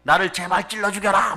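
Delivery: imitating a desperate pleading cry; mild mock wail of a mother. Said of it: "엄마들이 막 하소연해요".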